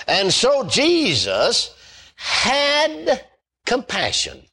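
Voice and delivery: And so Jesus, slow, then had leapt up very high before compassion; preacher driving home that Jesus had compassion; emphatic sermon line. Acted. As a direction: hang on had, lift it sharply, then land compassion. Preacher punches key word.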